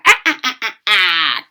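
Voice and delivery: a short mock witch cackle, ah ah ah; witch's cackle. Shown as a demonstration. Reading aloud, she demos what cackle means: light mock witch cackle. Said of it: "like, a witch's laugh".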